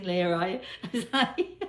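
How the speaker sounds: breaking up laughing, high-pitched; can't help cracking up; amused laughing. Real, not acted. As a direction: laugh through the words. Amused at viewer's odd question.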